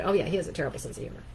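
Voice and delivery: rattled off fast, the real meaning; quick throwaway jab; fast snide aside. Shown as a demonstration. Speaker explaining the hidden insult, fast and snide. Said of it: "what you know is they've just said".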